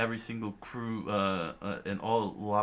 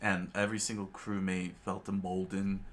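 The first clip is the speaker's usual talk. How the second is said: plain reading voice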